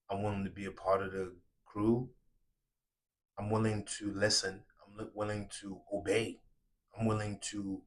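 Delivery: soft, low, humble pledge; sincere from the soul. Real, not acted. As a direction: offer yourself quietly and honestly. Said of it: "because I'm talking from my soul".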